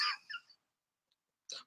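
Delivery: cracking up on the last word; breaking into laughter. Real, not acted. Host admitting an ex-husband also called, bursting out laughing. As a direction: lose it laughing at your own joke.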